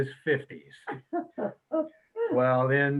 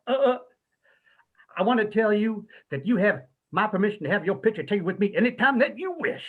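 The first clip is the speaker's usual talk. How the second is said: much higher, louder, grand showman imitation; 6 semitones higher, 3 dB louder than usual. Rock star offers the band photos, imitated with high flamboyant flair. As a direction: preen like a flamboyant rock star granting favors. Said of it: "he sticks his finger out like [name] does".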